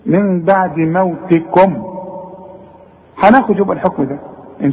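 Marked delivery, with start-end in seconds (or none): 0.1-1.8 من بعد موتكم slow with the م kept light and clear; clear meem. Shown as a demonstration. Teacher repeating the clear meem slowly. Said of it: "شوف".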